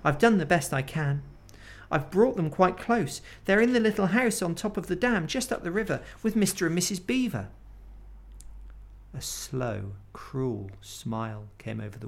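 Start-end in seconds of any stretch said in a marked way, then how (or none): 0.0-7.5 high, fast, anxious pleading. Acted. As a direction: beg her to believe you. Edmund pleads with witch.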